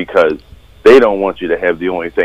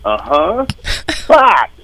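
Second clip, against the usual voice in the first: exasperated; 9 semitones higher, slower than usual. Retold or imitated. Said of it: "And I'm like".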